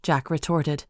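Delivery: ordinary delivery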